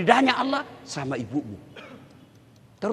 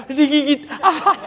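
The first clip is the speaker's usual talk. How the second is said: high, loud pained cry; wife in mock pain; 8 semitones higher, 7 dB louder than usual. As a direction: wail it comically.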